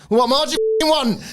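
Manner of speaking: exasperated outburst. Retold or imitated. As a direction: throw up your hands and yell, fast. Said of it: "It's like".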